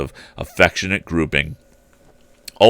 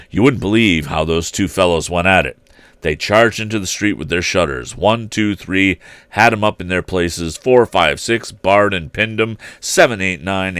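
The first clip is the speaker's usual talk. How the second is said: ordinary delivery, nothing marked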